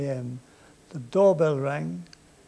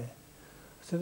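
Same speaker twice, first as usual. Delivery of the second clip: ordinary talk, nothing marked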